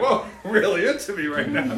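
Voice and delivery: loud, high, laughing mock surprise; mock flattered I. Speaker playing a hugged I, loud and laughing. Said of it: "They're like".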